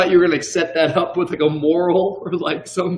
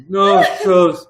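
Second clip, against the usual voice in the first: louder mock little-child talk; imitating young son; 5 dB louder than usual. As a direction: imitate a small son naming body parts, word by word. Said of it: "that's how he talks".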